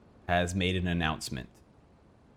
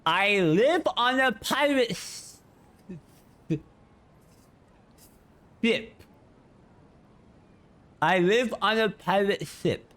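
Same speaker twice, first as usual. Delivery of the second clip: slow, higher, tongue out speech; tongue sticking out; 11 semitones higher, slower than usual. Shown as a demonstration. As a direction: say it with your tongue stuck out. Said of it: "while doing that?".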